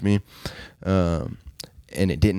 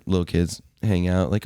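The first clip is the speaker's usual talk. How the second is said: ordinary delivery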